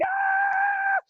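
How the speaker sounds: very high, sustained mock scream; falling scream. Skydiver thrown out of the plane, long high scream. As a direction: let out a long piercing acted scream. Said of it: "I'm just screaming".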